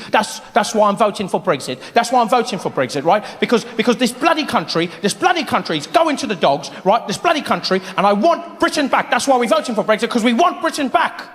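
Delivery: put-on a light British accent, grumbling; mock a Brexit voter in a British accent; imitating British Brexit voter. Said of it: "people on the news talking to the BBC".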